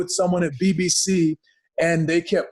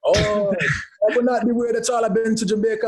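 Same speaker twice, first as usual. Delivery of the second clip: put-on playful Jamaican accent, higher pitched; 6 semitones higher than usual. Wrestler answering his co-host's joke, in a Jamaican accent. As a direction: switch on a lilting Jamaican accent. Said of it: "you have to have a Jamaican accent".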